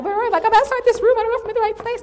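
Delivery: loud, high-pitched mock panic; imitated panicked shouting. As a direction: act out someone lost, yelling for help. Lost man calls again, acted out.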